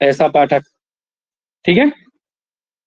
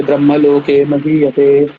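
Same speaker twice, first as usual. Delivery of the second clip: verse recitation; 3 semitones lower, slower than usual. Shown as a demonstration. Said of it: "Sanskrit verse recitation".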